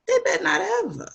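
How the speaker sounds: high-pitched, emphatic warning; stern warning. Real, not acted. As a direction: warn like a mother who won't allow it. Mother warns about her sons.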